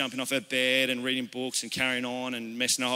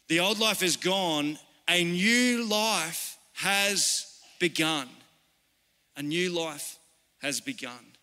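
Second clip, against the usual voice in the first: slow, weighty Bible reading; solemn, drawn-out declaration with pauses; scripture reading; slower than usual. Real, not acted. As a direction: stretch each phrase out and let it land. Reads scripture to congregation.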